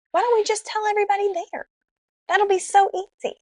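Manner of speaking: high-pitched, excited bright idea; retold giddy brainwave; eager suggestion. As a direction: pitch it way up, selling the plan.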